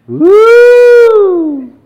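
long high loud "woo"; joyful whoop. Shown as a demonstration. Preacher showing how rejoicing sounds, joyful whoop. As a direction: whoop it out with sustained joy. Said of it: "you also make some noises".

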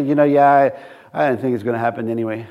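low, soft, resigned mumble; acted defeatism, voice dropped right down; negative. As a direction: give up on it under your breath. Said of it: "you speak negative".

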